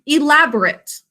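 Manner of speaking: ELABORATE with a short, reduced last syllable, "-rit"; adjective pronunciation. Shown as a demonstration. Teacher introduces the adjective to class, ELABORATE ending in unstressed "-rit". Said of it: "we pronounce it a little differently".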